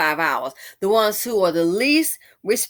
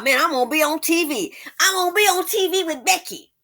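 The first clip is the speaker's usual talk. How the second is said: high, loud, giddy mock voice of the man; mock excited dupe; 8 semitones higher, 4 dB louder than usual. Mocking the man in the ad, high giddy voice. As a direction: ham up a silly man thrilled to be on TV.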